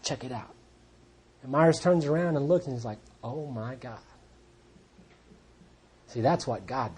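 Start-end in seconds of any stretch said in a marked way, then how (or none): 0.0-0.5 very soft and low; choked-up hush. Retold or imitated. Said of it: "And I start to cry". 3.2-4.0 low, barely voiced awe; stunned hush. Friend sees the changed man, stunned.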